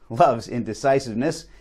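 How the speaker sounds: usual speaking voice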